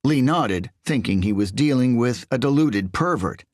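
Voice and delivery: plain reading voice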